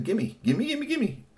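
high, rapid squeaky demand; greedy ninja squirrels. Acted. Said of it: "they only said".

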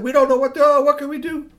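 high, loud helpless wail; mock panic. A narrator's reading. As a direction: act out a flustered panic at high pitch.

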